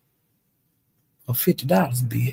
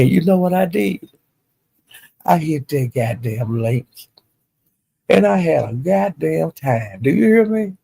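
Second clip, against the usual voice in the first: ordinary talk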